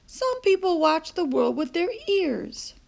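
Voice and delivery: a touch of a grandmother's teasing. A narrator's reading. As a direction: answer the boy with a playful wink. Nana teaches CJ.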